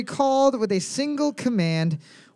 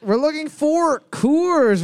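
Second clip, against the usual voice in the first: high, slow, drawn-out announcement; host announcement; 8 semitones higher, 4 dB louder, slower than usual. Acted. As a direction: call the answer out like a game-show host. Quiz host announcing the answer.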